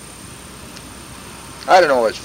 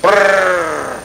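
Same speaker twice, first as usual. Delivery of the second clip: long high trilled burst; imitated machine gun; 5 semitones higher than usual. Veteran demonstrating enemy gun, drawn-out trill. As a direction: rattle off gunfire with the tongue. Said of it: "a Jap machine gun will go".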